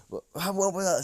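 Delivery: high, loud flustered questions; stunned outburst. Acted. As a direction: blurt it out in shock. Class stunned by the armor.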